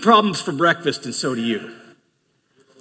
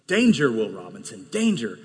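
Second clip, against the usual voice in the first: robot alarm; 6 semitones lower, 3 dB quieter than usual. Retold or imitated. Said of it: "My emotions say".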